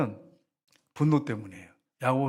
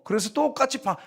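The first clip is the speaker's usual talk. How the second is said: ordinary talk, nothing marked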